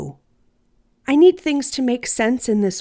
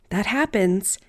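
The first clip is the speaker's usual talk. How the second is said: plain reading voice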